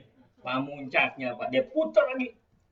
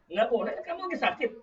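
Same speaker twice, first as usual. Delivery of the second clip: raised-pitch parental no; firm loud refusal; 8 semitones higher, 4 dB louder than usual. Acted. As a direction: put your foot down as the parent. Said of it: "Nggaknya kenceng". (larangan orang tua, tegas dan kencang)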